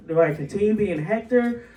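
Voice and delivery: ordinary delivery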